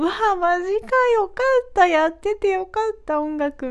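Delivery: high, bright burst of delight; overjoyed inner voice. Retold or imitated. Singer reliving her joy at praise, high excited gush. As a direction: gush like you just can't believe your luck. Overjoyed thoughts after a compliment.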